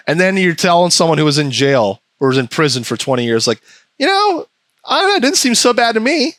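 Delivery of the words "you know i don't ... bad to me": high-pitched clueless know-it-all; mock nonchalance. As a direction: airily dismiss it in a high voice. Mimics clueless prison tourist.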